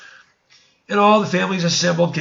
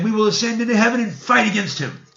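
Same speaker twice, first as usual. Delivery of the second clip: rebel's boast; 7 semitones higher than usual. Acted. Rebel group's vow in a story.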